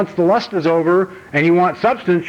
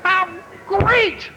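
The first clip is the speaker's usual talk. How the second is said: elated shouting. Pretend. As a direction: shout it out like you finally made it. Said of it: "I should have been able to jump up, run around the room, scream and shout".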